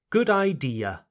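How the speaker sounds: Good idea said eye-DEE-uh, clear final A; model for repeating. Shown as a demonstration. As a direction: say Good idea for learners to copy. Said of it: "Repeat after me".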